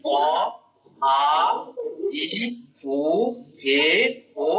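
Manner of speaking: অ, আ, ই, উ, এ, ও spaced out one by one; teacher listing the seven vowels; vowel recitation. Shown as a demonstration. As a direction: count off the vowels অ আ ই উ এ ও. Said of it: "সেগুলো কি কি".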